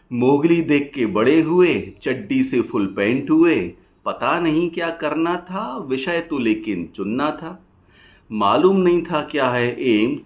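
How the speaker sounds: rhythmic rhymed verse, pausing after each line; poem recitation. Acted. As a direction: recite it as lilting stage poetry.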